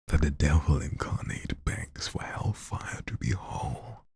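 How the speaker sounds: slow, hushed, whispered poem delivery; spoken-word poetry whispered to an audience; dramatic poem reading. Acted. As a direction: lean in and whisper the verse. Reads a poem aloud.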